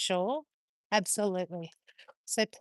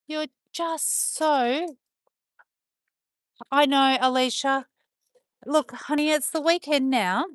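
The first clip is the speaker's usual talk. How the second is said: usual speaking voice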